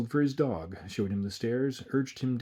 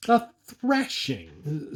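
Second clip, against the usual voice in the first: slow, outraged echo; a tiny man bristling under a mayor's nose; hopping angry; 5 semitones higher, 4 dB louder, much slower than usual. Acted. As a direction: drag the word out in furious disbelief. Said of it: "hopping with anger".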